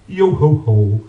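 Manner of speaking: low booming giant voice; giant's jingle. Acted. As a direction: sing out like the Green Giant. Green Giant jingle for sweetcorn.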